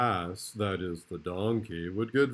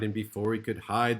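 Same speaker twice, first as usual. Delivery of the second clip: ordinary delivery, nothing marked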